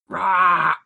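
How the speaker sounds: growled monster roar. Acted. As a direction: roar it like a beast.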